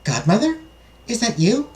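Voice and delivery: high-pitched, rising princess question. Acted. Princess calls out to her godmother, questioning. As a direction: ask it as a hesitant young princess, voice lifted high.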